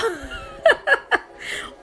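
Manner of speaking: long high-pitched laugh; high cackle of amusement; laughter. Real, not acted. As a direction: burst out laughing at your own story. Laughs at own punchline.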